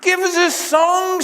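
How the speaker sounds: high, loud pleading call across the pub; imploring landlady. Acted. Landlady Mabel begs Sam for a song, high and loud. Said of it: "she implores".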